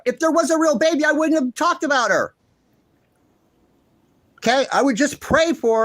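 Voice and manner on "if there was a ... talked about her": heated outburst. Real, not acted. Heated rant about fake baby.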